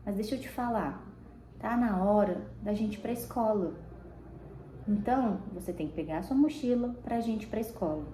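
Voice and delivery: soft, steady and gentle; calm low voice. Shown as a demonstration. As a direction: talk the child calmly toward school. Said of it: "fala com uma voz calma". (voz baixa e calma, chamando para a escola)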